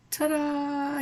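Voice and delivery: high showy ta-da; high ta-da reveal. Real, not acted. Showing viewers the finished manicure, high ta-da. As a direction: present it way up high like a magic trick.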